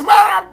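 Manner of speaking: high, loud wordless holler; frantic mock yell. Waving a boarding pass at the gate, frantic imitated yell. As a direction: yelp in comic panic.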